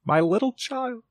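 breaking down. A narrator's reading. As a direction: let the grief break through. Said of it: "He broke down all at once".

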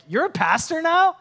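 high-pitched question of disbelief; can't believe it; incredulous classmates. Retold or imitated. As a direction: imitate astonished classmates, pitch climbing on the question. Classmates' surprised question, acted.

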